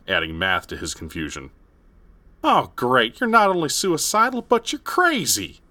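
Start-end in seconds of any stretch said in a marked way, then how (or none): 2.4-5.6 high, sarcastic man's voice. Acted. Ted scoffing.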